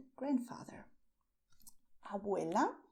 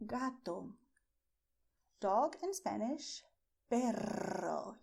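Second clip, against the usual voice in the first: ordinary delivery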